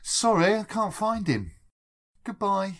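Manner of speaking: brightly fob off a caller on the fake phone line; mock receptionist. Pretending to answer the podcast phone, a mock receptionist brush-off.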